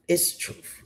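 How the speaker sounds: breathy whisper, no voice; whispered aside. Real, not acted. To her viewers, repeating her claim in a whisper. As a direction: lean in and whisper it like a secret.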